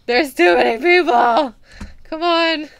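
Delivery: high, loud flustered complaint; overwhelmed cry. Real, not acted. Shopkeeper swamped by customers, loud and flustered. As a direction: shout it in a panic.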